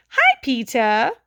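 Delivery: loud, slow teasing hi; cheeky greeting. A narrator's reading. Little brother after dropping ice down Peter's back, cheeky. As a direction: sing it out mischievously.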